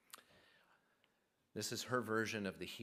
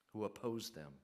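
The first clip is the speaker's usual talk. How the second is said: usual speaking voice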